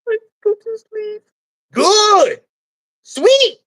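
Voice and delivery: high, bright and eager; high vagina voice. Acted. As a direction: chirp it up high. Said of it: "But you gotta talk like that".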